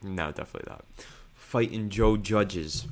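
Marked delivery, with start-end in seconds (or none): 1.3-2.9 slow, drawn-out bad Boston accent; failed Boston accent. Shown as a demonstration. Co-host trying the impression for the host, slow, unconvincing Boston accent. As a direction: attempt Boston, land nearer Italian-American mobster. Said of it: "That didn't sound very Boston".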